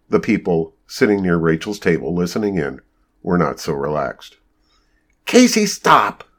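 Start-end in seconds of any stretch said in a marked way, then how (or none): 5.2-6.2 high, drawn-out shouted order. A narrator's reading. Rachel orders Casey to stop arguing, shouting. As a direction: shout slowly to halt the rant. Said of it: "Shouted Rachel".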